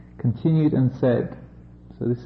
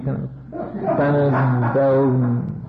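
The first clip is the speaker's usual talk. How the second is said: ordinary delivery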